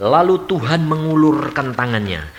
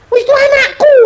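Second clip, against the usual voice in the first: much higher, louder clinging cry of a parent; possessive parent; 8 dB louder than usual. Acted. Preacher mocking a parent who clings to a married child, high squeal. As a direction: squeal it like a mother who won't let go. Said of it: "Kamu bilang apa?".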